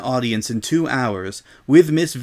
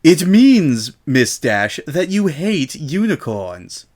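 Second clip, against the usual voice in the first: loud, high, slow spelled-out condescension; cold explaining; 4 semitones higher, 5 dB louder, slower than usual. Acted. Miss Vinton spelling out the accusation to Rainbow, slow and condescending. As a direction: talk down to her as if to a foal.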